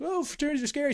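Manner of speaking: high, mocking fearful tone; mock scared voice. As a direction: pitch it way up like a nervous outsider. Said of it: "everyone's like".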